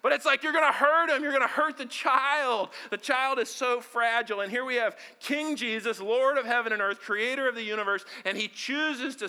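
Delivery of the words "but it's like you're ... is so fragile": higher, louder, fast worry; mock panic. New father fearing he'll hurt the baby, anxious. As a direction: rush it like a nervous dad. Retelling fear of hurting infant.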